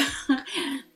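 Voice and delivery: a short, shy giggle at herself; embarrassed laugh. Real, not acted. A creator laughing at herself for talking to her laptop, embarrassed giggle. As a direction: laugh off the awkwardness of filming alone. Nervous about talking to computer.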